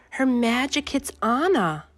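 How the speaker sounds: plain reading voice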